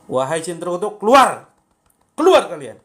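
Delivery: furious shout driving out the jinn; real snap at the jinn. Shown as a demonstration. Healer demonstrates to viewers how to snap at a jinn, shouting. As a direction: yell the command with full force. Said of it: "bentaknya dengan bentak beneran".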